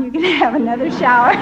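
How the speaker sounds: bursts into real laughter mid-line; giggling at the memory; laughing. Real, not acted. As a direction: laugh through the punchline, then a high breathy laugh. Laughing over shower memory.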